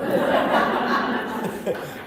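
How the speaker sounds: laugh. Real, not acted. Laughs at his own impression.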